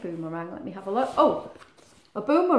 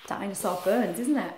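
ordinary delivery, nothing marked

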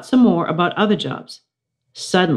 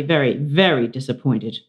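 ordinary delivery, nothing marked

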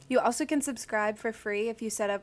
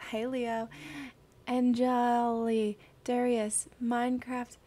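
ordinary talk

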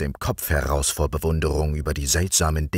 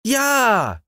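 much higher, louder eager cry; king crying out eagerly to the nightingale, high and loud; king's shout; 4 dB louder than usual. A narrator's reading. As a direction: burst out in delight. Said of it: "schrie der König".

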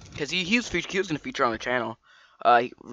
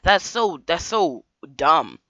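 drawn-out, very slow dismissive words; dragged out, scornful; slow disdain; 3 semitones higher, much slower than usual. Real, not acted. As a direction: stretch every word in disbelief. Ranting about sleeping early.

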